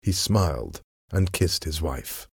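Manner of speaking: plain reading voice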